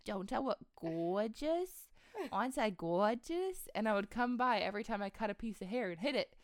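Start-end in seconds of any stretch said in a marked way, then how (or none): 0.0-3.7 imitated childish lisp-like "gorgeous", proudly showing off; childhood speech impediment. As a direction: drag out "gorgeous" like a small child. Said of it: "a speech impediment".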